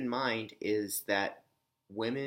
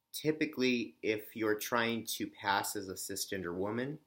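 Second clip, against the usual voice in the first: usual speaking voice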